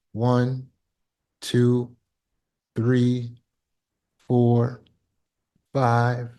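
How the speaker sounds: slow, soft count to five. Shown as a demonstration. Teacher counts out the pause, slow and soft. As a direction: count slowly, letting seconds pass. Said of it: "allowing the seven seconds".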